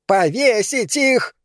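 a hateful woman screaming for a hanging; shrill, furious howl from the crowd; screeching yell. A narrator's reading. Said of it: "завопила она".

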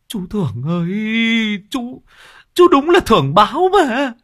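high, rising, fed-up complaint; exasperated whine. Acted. Thiên complains to the clumsy old man, exasperated. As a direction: voice cracking in disbelief.

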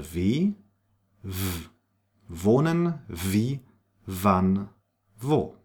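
W said as an English V; German W as V. Shown as a demonstration. Teacher models the German W for learners, clear V sound. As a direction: sound the W in Wohnen, wie, wann, wo as V. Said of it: "as it is pronounced".

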